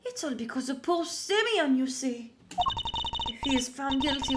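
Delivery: put-on fretful, French-accented pleading; theatrical worry with a French accent; worried French warden. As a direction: plead dramatically as a doting French matron. Voices a game character.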